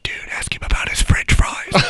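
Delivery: acted whisper, all breath; conspiratorial whisper. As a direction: whisper it like passing on a prank. Retold conspiratorial prompt to coworkers.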